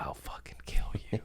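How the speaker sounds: hissed threat under the breath, half-joking; a sweet-sounding death threat, whispered; mock murderous whisper. To a partner the next day, a whispered threat. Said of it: "you're like".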